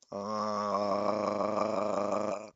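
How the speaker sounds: one steady sustained "uh" tone; long held tuning note. Acted. Bard tuning the lute before playing, held vocal note. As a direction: drone a single note to tune up.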